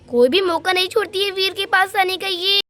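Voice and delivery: normal talking voice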